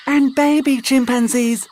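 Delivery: put-on high, puzzled little girl; a child asking in a high voice; high girl's voice. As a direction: squeak it out puzzled. May voiced.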